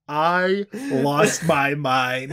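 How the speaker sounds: ordinary delivery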